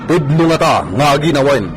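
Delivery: normal talking voice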